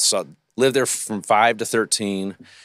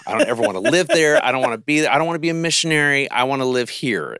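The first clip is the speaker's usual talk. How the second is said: mom's pleading prayer rising higher and louder; 7 semitones higher, 4 dB louder, faster than usual. Retold or imitated. Mom praying she won't have to go, rising plea. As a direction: beg the Lord, climbing higher, rushing the last line. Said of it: "she was like".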